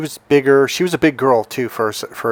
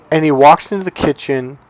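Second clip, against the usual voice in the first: ordinary delivery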